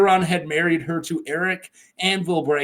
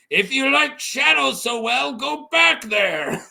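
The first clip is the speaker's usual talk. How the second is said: raised, high-pitched mock yell; heckling yell; 8 semitones higher than usual. Carl interrupts Euron's speech by yelling, high heckling yell. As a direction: holler it over the speaker to interrupt. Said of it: "Carl interrupts him by yelling".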